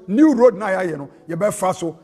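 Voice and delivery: ordinary talk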